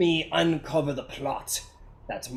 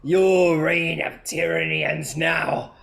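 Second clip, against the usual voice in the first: slow, louder grand proclamation; villain declaration; 3 semitones higher, 5 dB louder, slower than usual. Acted. Frost Lord declaring the end of the queen's rule, slow and grand. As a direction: proclaim the end of her reign. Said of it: "He goes".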